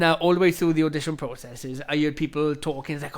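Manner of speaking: usual speaking voice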